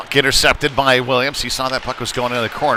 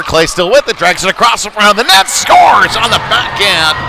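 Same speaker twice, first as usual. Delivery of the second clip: rising to a loud, high shout on the goal; goal call; 7 semitones higher, 6 dB louder than usual. Real, not acted. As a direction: call the goal with everything you've got. Goal scored, announcer erupts.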